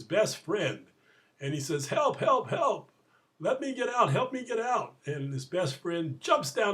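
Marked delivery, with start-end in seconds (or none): 1.9-5.0 high, loud mock plea; retold yell for help. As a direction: cry out to a friend as the trapped man. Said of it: "the man yells again".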